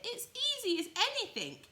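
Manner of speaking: high loud bragging wild rabbit; furry rabbit voice. Acted. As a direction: show off, high and loud like a cocky bunny. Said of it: "said the furry rabbit".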